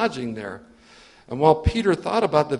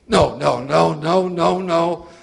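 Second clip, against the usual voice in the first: loud repeated no's; frantic denial; 6 dB louder, slower than usual. Acted. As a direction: push the command away in panic. Peter panics at the voice.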